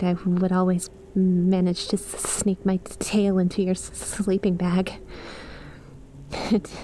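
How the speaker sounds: ordinary talk, nothing marked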